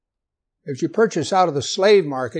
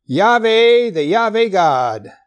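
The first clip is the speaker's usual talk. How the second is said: loud, solemn proclamation of God's name; proclaiming; 4 semitones higher, 6 dB louder, slower than usual. Acted. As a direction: proclaim the name out loud, high on the first word. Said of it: "he passed by before him and proclaimed".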